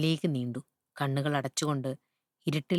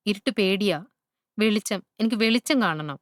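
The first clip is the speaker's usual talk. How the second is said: lightly acted wail, pitched higher; a touch of panic, raised and insistent; mildly frightened plea; 5 semitones higher, 4 dB louder than usual. A narrator's reading. Loris afraid of the dark begs the child for light, mildly frightened. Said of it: "നിലവിളിയും തുടങ്ങി".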